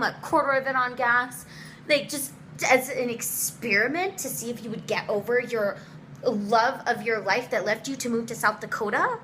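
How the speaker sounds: ordinary talk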